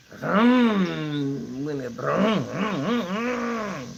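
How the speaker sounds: revving motor noise with the voice; motorcycle revving. Retold or imitated. Showing how fast her temper goes, motorcycle vroom. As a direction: rev it up like a motorcycle engine. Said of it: "I can go, man, like a motorcycle".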